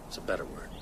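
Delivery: grim whispered correction; whispered aside. Acted. Ben whispers grimly to Mark about killing them. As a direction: drop to a bare whisper.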